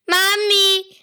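high, loud yell of Mami!; child yelling. Acted. Boy shouting for his mother, loud and high. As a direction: shout Mami! like a kid losing patience.

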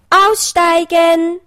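bunny ordering the foxes out of the car, drawn out; drawn-out command. A narrator's reading. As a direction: stretch it like a driver's announcement. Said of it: "rief er".

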